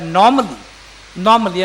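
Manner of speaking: "normally" said firmly, then repeated for emphasis; stress on normally. Real, not acted. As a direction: underline "normally" with weighty, deliberate stress. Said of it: "underline the word normally".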